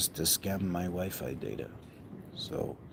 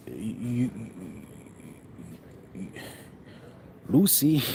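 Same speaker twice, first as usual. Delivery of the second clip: Ricky Ricardo style call; 3 semitones higher than usual. Retold or imitated. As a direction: call her name like an exasperated sitcom husband. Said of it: "you got some splaining to do".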